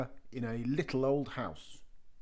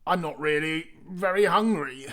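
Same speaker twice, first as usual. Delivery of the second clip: put-on a light old man's voice, louder; old man's polite excuse; 3 semitones higher, 7 dB louder than usual. A narrator's reading. The old man politely declines, lightly voiced. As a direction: politely turn it down. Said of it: "said the little old man".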